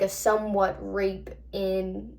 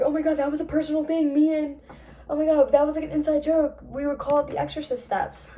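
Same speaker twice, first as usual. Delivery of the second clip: higher, fast, rattled disbelief; a girl spooked by a private joke; retold freak-out; 6 semitones higher than usual. June freaks out at the message.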